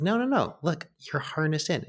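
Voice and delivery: put-on high, eager kid insisting; lightly voiced boy, coaxing; imitating a reassuring kid. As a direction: reassure him like a bouncy ten-year-old. Kid reassuring scared adult.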